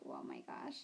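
breathed out, barely voiced; cringing under her breath; embarrassed whisper. Real, not acted. Recalling saying it to the teacher, whispered cringe.

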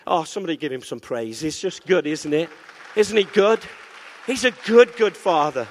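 usual speaking voice

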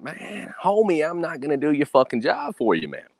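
high-pitched, mock-exasperated pushback; retold exasperated refusal. Target refusing to coach the scammer, mock exasperated. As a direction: protest it high, waving off the request. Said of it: "And I was like".

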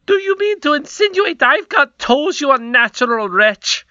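high, loud furious cry; outraged queen. A narrator's reading. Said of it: "cried the queen".

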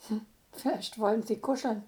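plain reading voice

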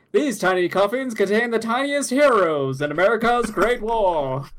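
put-on old-timey newsreel announcer, high and loud; booming wartime newsreel voice; 1950s narrator. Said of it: "old-timey newsreels".

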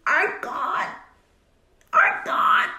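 mock grief. Thisbe mourning dead Pyramus.

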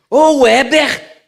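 loud, high, drawn-out mother's yell; mãe mudando o tom. Retold or imitated. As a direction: yell for your child, stretching the name. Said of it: "já mudou o tom e tal". (grito de mãe brava)

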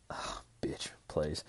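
breathy whispered scorn; dismissive whispered retort. Retold or imitated. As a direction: hiss it under the breath. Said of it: "Dakin's just like".

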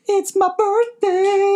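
very high, slow squeaky quote. Retold or imitated. Korg-style quote, very high.

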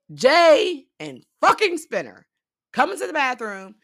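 loud, high and slow, spitting out the names in frustration; exasperated outburst. Real, not acted. Recapping the show to viewers, fed up and loud. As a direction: hit each name like you can't believe them.

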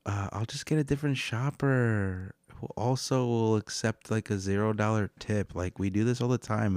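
mocking entitled customer. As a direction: parody a careless customer brushing him off. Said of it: "And she goes".

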